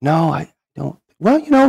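lightly voiced missionary, jumping up high; hesitant missionary. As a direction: reconsider in a high, drawn-in voice. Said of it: "And the missionary goes".

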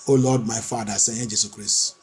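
ordinary talk

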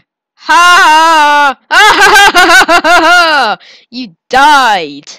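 loud high barking laughs; triumphant laughing. Real, not acted. Player laughs at the cell he just killed, loud triumphant laughing. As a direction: laugh over the fallen enemy. Gloating laugh after kill.

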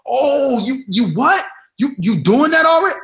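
high, loud mock astonishment; imitated surprise. A friend hearing your idea, in loud shocked surprise. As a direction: gasp it out like a friend who can't believe it. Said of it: "if it's like".

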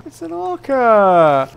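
high, slow frightened wail; terrified wail. Real, not acted. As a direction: wail it out in terror. Spotting an orca in the water.

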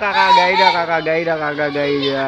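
loud long call, fading with each repeat. Real, not acted. Vlogger calling for Kakak Gaida outside, loud call fading. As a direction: call her name across the yard, then let it die down.

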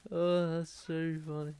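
laughing until choking; choking on laughter. Real, not acted. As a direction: gasp and choke through the laugh. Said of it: "sorry I'm choking".

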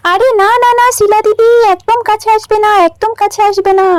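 high, urgent cry to keep away; alarmed warning. Acted. Sick Malati warns neighbour Shila off, high alarmed voice. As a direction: push her back in alarm.

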